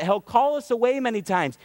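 normal talking voice

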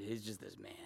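very low, soft, dismissive drop; hushed, offhand disowning; nonchalant. Acted. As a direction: toss it away under your breath. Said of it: "That nonchalant".

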